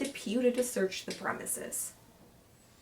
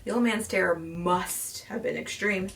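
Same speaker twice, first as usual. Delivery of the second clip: usual speaking voice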